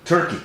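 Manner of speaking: "Turkey" dicho en inglés; English pronunciation. Shown as a demonstration. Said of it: "lo que ahorita es Turquía". ("Turkey" con pronunciación inglesa)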